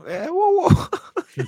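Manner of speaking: high startled exclamation; surprised whoa. Real, not acted. Sudden surprised outburst.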